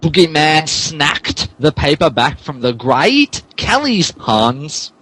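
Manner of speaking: ordinary talk, nothing marked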